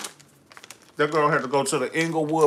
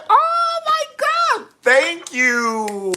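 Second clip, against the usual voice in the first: high, slow squeal of thanks; delighted squeal; 8 dB louder, much slower than usual. Real, not acted. Thanking a fan for the review, squealing. Reacting to a viewer's compliment.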